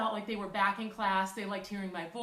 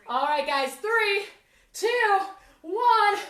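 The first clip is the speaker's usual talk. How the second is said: loud, higher countdown; shouted countdown; 8 semitones higher, 9 dB louder, much slower than usual. Real, not acted. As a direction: count them down with energy. Counting down last rep.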